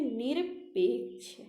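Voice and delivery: निरपेक्ष said slowly and deliberately; teacher modelling निरपेक्ष; slow careful pronunciation. Shown as a demonstration. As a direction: draw out निरपेक्ष slowly for students. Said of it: "क्या होता है".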